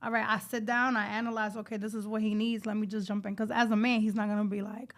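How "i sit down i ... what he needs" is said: ordinary delivery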